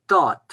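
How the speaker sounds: "taat": long A, final D pronounced as a sharp T; Breton taat with final T. Shown as a demonstration. Teacher showing the final D of "taat" turning into a T. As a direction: end "taat" on a crisp T sound. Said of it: "se prononce T".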